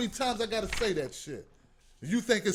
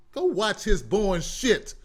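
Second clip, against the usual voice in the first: normal talking voice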